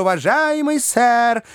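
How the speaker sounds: high, drawn-out polite protest; high-voiced, pleading interjection; fussy little man. A narrator's reading. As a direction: cut in as a fussy little gentleman, pitched high. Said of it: "вмешался маленький человек".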